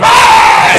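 loud breathy mock yell; mock scream back. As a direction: roar back at the mirror playfully. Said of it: "And I went".

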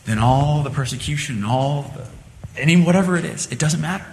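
ordinary delivery

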